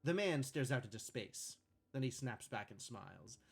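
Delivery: calm scene-setting read; script narration. A narrator's reading. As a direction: read the stage directions evenly.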